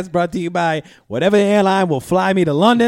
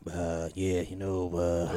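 much lower, quieter put-on commentator voice; Dennis Scott impression; 13 semitones lower, 14 dB quieter, slower than usual. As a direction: drop way down and mumble like the TV analyst. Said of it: "The way he talks".

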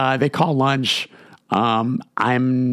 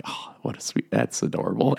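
breathy whisper to himself; a hushed, unvoiced aside; 6 dB quieter than usual. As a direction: whisper it, charmed. Aside about sweet question.

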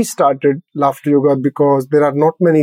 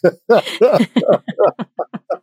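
higher, bubbling laugh; rolling ha-ha tailing off; laughing at own laughter; 6 semitones higher than usual. Shown as a demonstration. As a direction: break into laughter at yourself. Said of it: "start laughing at your own laughter".

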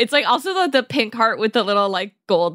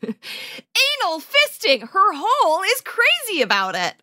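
hammed-up title read; 9 semitones higher than usual. Co-host reading a racy title to co-host, hammed-up and high.

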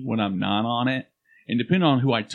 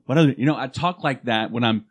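ordinary talk, nothing marked

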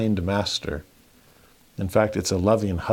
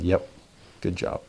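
smiling praise; 4 semitones lower, 6 dB quieter than usual. Retold or imitated. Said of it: "And I smiled".

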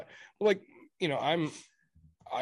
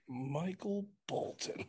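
slow, softer, higher-pitched questioning of the name; film-line impression; 5 semitones higher, 7 dB quieter, much slower than usual. Quoting Office Space to fellow fans, slow mock puzzlement. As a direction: draw out each name, quietly incredulous.

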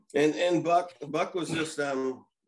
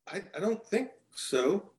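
higher, slow sceptical retort; doubtful retort; 7 semitones higher than usual. Retold or imitated. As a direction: drag it out, not buying it. Pitcher retorts to the umpire's call.

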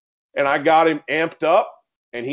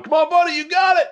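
high, loud excited cheer; cheering him on; 4 dB louder, faster than usual. Retold or imitated. Hunter amping up his swimming dog, cheering. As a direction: urge the dog on with a high-pitched call. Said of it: "cheering him on".

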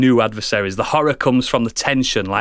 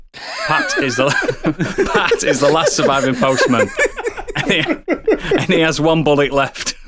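trying to read on through laughter; laughing, struggling to finish the line; cracking up; 4 semitones higher than usual. Real, not acted. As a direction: narration breaking into giggles. Reading his pitch while cracking up.

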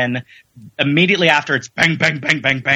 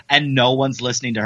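usual speaking voice

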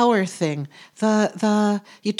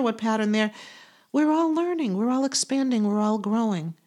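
ordinary talk, nothing marked

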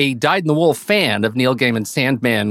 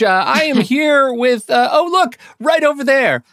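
excited; 11 semitones higher than usual. Acted. Playful intro of cohost.

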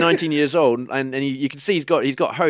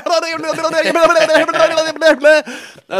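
high-pitched rapid babbling yell; smashed, screechy gibberish rant; screamed gibberish; 6 dB louder than usual. Retold or imitated. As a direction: babble loud nonsense at the world, one long high stream. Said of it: "screaming gibberish at the world's".

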